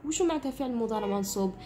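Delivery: ordinary talk, nothing marked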